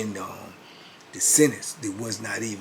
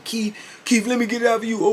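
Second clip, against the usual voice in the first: higher, louder eager calling with a laugh; eager inmates; 12 semitones higher, 6 dB louder than usual. Retold or imitated. Inmates in line calling to Keith for the paper, higher and eager. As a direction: beg for the paper, half laughing.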